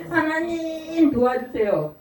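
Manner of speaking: earnest plea. Retold or imitated. Desperate believer crying out to God for help. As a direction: cry out to God with longing. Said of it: "너무나 간절히".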